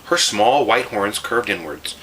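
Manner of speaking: plain reading voice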